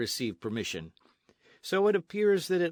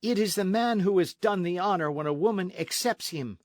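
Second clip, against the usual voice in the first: heated retort, higher and louder; angry; 7 semitones higher, 5 dB louder than usual. A narrator's reading. Señorita rebukes the captain's presumption, angry and upset. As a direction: correct him with wounded anger. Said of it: "cried angrily and almost in tears".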